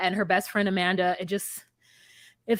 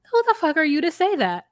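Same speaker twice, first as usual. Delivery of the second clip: indignant retort; 7 semitones higher than usual. Retold or imitated. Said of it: "And I was like".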